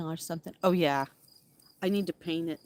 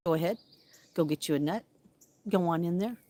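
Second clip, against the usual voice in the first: ordinary talk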